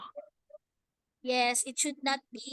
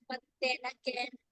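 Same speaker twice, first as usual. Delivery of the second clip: ordinary talk, nothing marked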